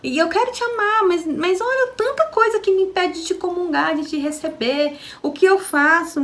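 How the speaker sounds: high, pleading prayer to the Lord; tearful prayer. Retold or imitated. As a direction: beg God through tears.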